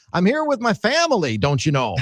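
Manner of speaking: mock high-pitched coach impression; hammed-up, high family-man quote; Brian Kelly. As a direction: send up the coach, pitch leaping on the first phrase. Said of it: "I hope it's Brian Kelly, because then he can go and say".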